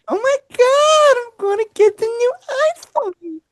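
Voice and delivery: high, slow squeal of joy; drawn-out girly squeal over a new phone; excited girlfriend. Retold or imitated. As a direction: gush like a thrilled teen girl.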